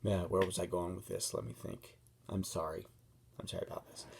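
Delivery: fading into a whisper; trailing off. Real, not acted. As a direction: lose the thread and whisper. Loses her thread, apologizes.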